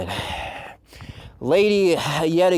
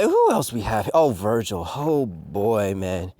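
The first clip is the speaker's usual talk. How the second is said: usual speaking voice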